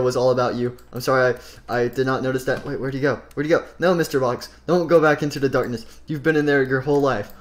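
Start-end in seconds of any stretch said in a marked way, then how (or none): none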